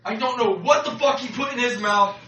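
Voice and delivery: normal talking voice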